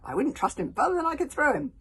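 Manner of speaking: put-on girl shouting to friends above; shouted up. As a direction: call up to him with scorn. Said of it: "Kamikaze shouted up".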